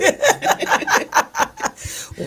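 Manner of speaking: breathy high burst of laughter. Real, not acted. Laughing at working joke.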